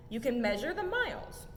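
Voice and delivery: ordinary delivery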